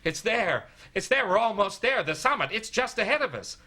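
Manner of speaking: high, eager bursts; excited. Retold or imitated. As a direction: imitate a friend bursting with news. Steve bounds down announcing summit.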